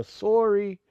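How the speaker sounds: loud, high Canadian "SORE-ee"; Canadian accent. Shown as a demonstration. Streamer showing off a picked-up habit, exaggerated Canadian accent. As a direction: play up the Canadian round O. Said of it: "making me talk like Canadians".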